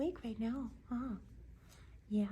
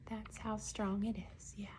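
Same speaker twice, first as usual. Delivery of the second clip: whispering; 8 dB quieter than usual. Real, not acted. Whispered to viewers.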